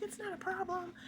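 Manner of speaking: reassuring friend. Retold or imitated.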